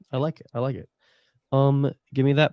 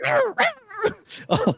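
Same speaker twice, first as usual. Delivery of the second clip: high-pitched small creature snarl; animal squeal. Acted. Held groundhog reacting to its captor, high squeal. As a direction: squeal like an angry little groundhog. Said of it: "He's like".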